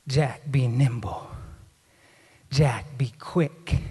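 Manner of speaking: low and very slow, with heavy pauses; mock-sinister nursery rhyme, drawn out; ominous rhyme reading. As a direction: read it like a grave warning.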